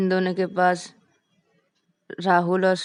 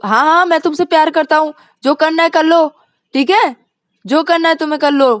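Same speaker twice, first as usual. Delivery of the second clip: loud, high defiant shout; 9 semitones higher, 10 dB louder than usual. Acted. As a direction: yell it after her as she leaves. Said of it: "चिल्ला चिल्ला के बोलती है". (चिल्लाकर चुनौती देते हुए)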